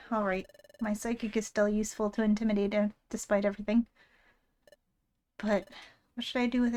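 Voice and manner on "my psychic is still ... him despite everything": ordinary talk, nothing marked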